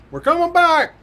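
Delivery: high, loud call back from far off; a voice calling out of the dark; distant call. Retold or imitated. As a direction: shout back across the golf course. Troopers calling from the darkness.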